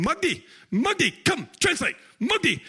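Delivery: high-pitched summoning call for a translator; imitated yelling. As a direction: call out sharply as if imitating an impatient man. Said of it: "And he starts yelling".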